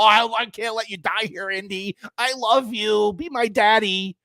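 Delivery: a hint of a gushing heroine, hammed up; mocking the heroine. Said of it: "she wants to s- fucking suck him all".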